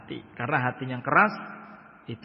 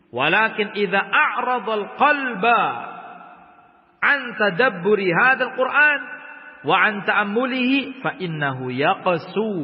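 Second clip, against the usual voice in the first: raised, formal Arabic reading voice; recited Arabic from the book; Arabic text recitation; 6 semitones higher, 7 dB louder than usual. Acted. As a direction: read the Arabic passage out clearly and higher. Reads Arabic kitab text.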